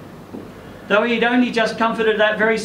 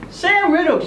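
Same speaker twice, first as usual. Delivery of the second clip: woman's voice, higher, surprised greeting; Lynn's voice; 11 semitones higher than usual. Acted. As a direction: call his name up through the car window. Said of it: "she said".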